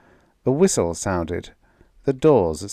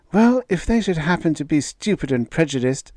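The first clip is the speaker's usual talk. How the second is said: put-on higher, earnest young daughter; a daughter defending her fiancé, pitched higher; young woman's voice; 8 semitones higher than usual. A narrator's reading. Daughter defending Gregrill to her father, higher young voice.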